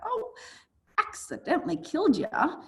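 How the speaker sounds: high-pitched, comically casual oops; mock oops. Teacher playing Romeo accidentally killing Paris, mock oops. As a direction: toss it off as a high, mock-innocent blunder.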